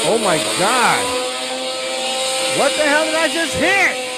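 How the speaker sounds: loud, high shocked outburst; shocked by a sudden blast of sound; yelling in real alarm at the noise; startled shout. Real, not acted. Accidentally hit a sound button.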